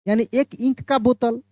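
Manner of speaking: ordinary delivery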